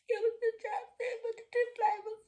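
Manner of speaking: tiny high-pitched weepy squeak; mock crying. Teller confesses the chapstick theft, squeaky mock sobbing. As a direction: whimper it in a very high voice.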